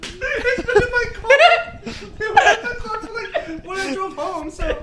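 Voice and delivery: high laughing voice. Real, not acted. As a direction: laugh while explaining. Laughing embarrassed explanation.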